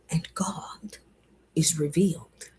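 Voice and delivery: soft unvoiced whisper. Real, not acted. Speaker whispering the closing affirmation. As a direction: hush it like a revelation.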